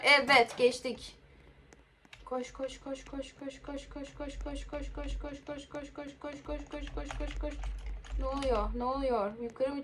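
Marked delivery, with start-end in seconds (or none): 2.2-7.5 soft, rhythmic chant under the breath; hushed urging. Real, not acted. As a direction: urge yourself along very quietly. Oyunda koşarken kendi kendine tekrarlıyor. (alçak sesle tempolu mırıldanma)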